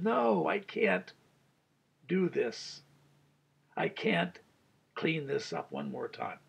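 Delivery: usual speaking voice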